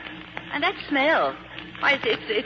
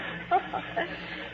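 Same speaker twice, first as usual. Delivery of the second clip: shy breathy giggle; 4 semitones higher, 8 dB quieter than usual. Acted. She laughs at being called heroine.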